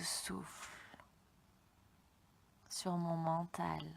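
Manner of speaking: slow, hushed meditation voice; soft guided meditation. Acted. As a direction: whisper it slowly and calmly. Closing calm reflection.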